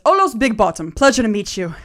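loud, jovial halfling greeting; hearty introduction. Acted. Halfling offering his rescuer a handshake, hearty introduction. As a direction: introduce yourself with gusto.

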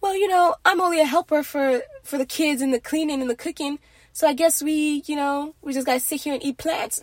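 mock meek wife. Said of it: "the wife is not gonna be like".

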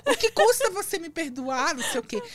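hammed-up begging for forgiveness; half-mocking diva plea; dramatic plea. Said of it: "Isso em frente de todo mundo".

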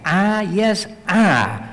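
"I, yes, I" with both "I"s punched; emphatic I. Shown as a demonstration. Preacher stressing that faith is personal, emphatic "I". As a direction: drive home each "I", pitch lifted at the start. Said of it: "It's emphatic".

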